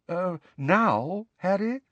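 high, hesitant query; discomfited. A narrator's reading. Ghost caught by Harry before the feast, discomfited. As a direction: stall uneasily, voice lifting. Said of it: "looking discomfited".

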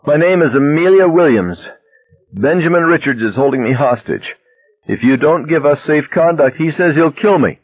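high-pitched plea across a distance; hostage calling out. A narrator's reading. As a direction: announce it high and urgent to police. Hostage calls out to the police.

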